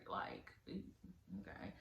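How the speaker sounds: breathless unvoiced "okay", barely there; whispered under the breath in disbelief; whispered aside. Real, not acted. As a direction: drop it to a flat whisper like a side comment. Exasperated aside after the roses detail.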